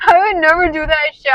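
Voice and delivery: high laughing denial, no dude; laughing protest. Retold or imitated. To the documentary makers, a high laughing denial. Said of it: "I'm like".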